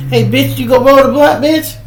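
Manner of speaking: high, loud, fast yelled orders at his sister; brother yelling orders. Retold or imitated.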